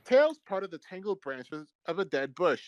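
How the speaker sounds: ordinary talk, nothing marked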